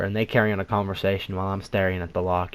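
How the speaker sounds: usual speaking voice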